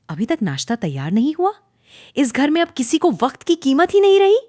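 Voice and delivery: sharp, rising, loud scolding; scolding mother-in-law. A narrator's reading. Mother-in-law scolding daughter-in-law over late breakfast, sharply. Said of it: "तेज आवाज़ आई".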